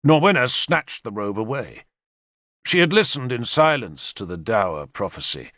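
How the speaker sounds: ordinary delivery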